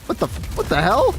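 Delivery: surprised. Real, not acted. Streamer reacts to a game glitch, startled outburst. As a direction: caught off guard by the glitch, voice jumps up.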